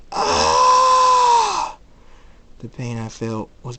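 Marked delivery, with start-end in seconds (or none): 0.1-1.8 long, high, loud scream; pained scream. Acted. Character screams as the pain hits, agonised. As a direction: scream out in sudden agony.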